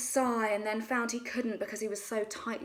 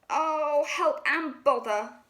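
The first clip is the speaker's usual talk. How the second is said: slow, high fretful bear; stuck Pooh; 5 semitones higher, 5 dB louder, slower than usual. A narrator's reading. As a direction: groan it out while wedged tight. Stuck Pooh calls for help.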